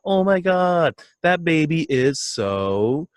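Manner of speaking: high, slow, drawn-out coo; mock cooing at a baby photo; gushing over baby. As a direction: swoon over the baby picture. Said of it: "and you hear".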